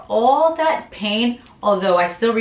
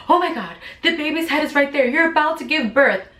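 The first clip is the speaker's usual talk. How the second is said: startled doctors; 7 semitones higher, faster than usual. Retold or imitated. Doctors suddenly see the head.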